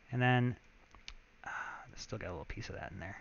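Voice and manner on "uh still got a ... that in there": whispering. Real, not acted. Muttering while editing audio.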